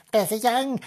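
high, lightly put-on little girl; a hint of ghostly girl; young girl's voice. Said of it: "a young girl's voice been heard".

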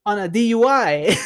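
high-pitched quote breaking into laughter; amused retort. Retold or imitated. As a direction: imitate a comeback while starting to crack up.

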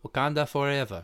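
put-on Wakandan accent on Wakanda Forever; movie accent. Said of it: "You don't gotta be racist about it".

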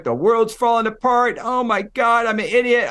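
half-acted panicked yelling behind the wheel; retold yelling. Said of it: "I'm screaming, I'm yelling".

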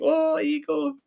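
slow, very high mock discovery; high drawn-out "ah". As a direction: stretch it out up high like spotting a rare bird. Spotting ego on TV.

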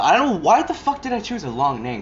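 frustrated outburst at himself; exasperated. Real, not acted. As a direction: vent in annoyed disbelief. Annoyed at his own long name.

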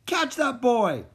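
put-on high, slow shouted catch that boy!. A narrator's reading. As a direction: yell after the fleeing boy. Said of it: "he heard shouts of".

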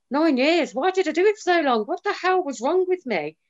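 high-pitched, fast self-reproach; exasperated disbelief. Retold or imitated. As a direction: pitch jumps up in shocked disbelief at herself. Looking back on nine years.

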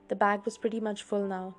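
usual speaking voice

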